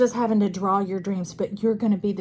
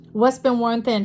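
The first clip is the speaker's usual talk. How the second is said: ordinary delivery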